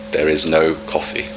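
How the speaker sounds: normal talking voice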